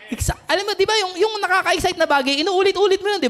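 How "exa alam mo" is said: ordinary talk, nothing marked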